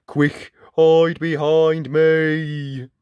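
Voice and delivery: loud, drawn-out heroic tin man; urgent tin man. Acted. Tin Can shielding his friends, loud drawn-out warning.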